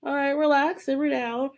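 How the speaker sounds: high, mock soothing; cool her down gently; calming Prue. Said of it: "she's just like".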